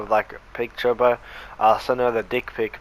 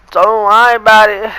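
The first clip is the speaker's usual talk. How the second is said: high, louder brushing-off voice; mock girl brush-off; 10 semitones higher, 9 dB louder than usual. The crying girl brushing off the teacher, high and loud. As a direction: squeal it like a tearful girl. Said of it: "she was like".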